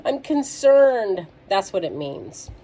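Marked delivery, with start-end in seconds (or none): 0.0-1.3 loud, lifted demo of worry; exaggerated fretting, drawn out; worried. Shown as a demonstration. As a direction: act out being stressed and concerned. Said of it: "when you are worried or you're stressed out".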